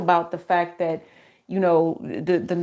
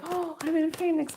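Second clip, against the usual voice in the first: high, softer mock caller; imagined visitor; 9 semitones higher, 5 dB quieter than usual. Unexpected visitor phoning the host, high playful imitation. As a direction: lightly mimic someone announcing a surprise arrival.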